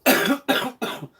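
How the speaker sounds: high, harsh choking cough; coughing fit. Real, not acted. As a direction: cough hard on a swallowed drink. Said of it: "it went down the wrong hole".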